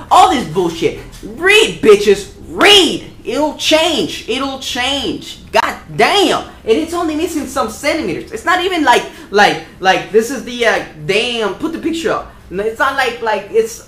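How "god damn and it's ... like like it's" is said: ordinary delivery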